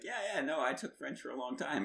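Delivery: high-pitched, overeager reassurance; hammed-up high protest of competence; breezy bluffing. Acting out bluffing to his friend.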